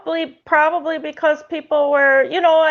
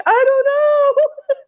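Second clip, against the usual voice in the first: very high, slow, giggled; a helpless little-kid shrug, laughing; laughing child; much slower than usual. Retold or imitated. Child telling her mother she doesn't know, laughing and very high. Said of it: "I'm like".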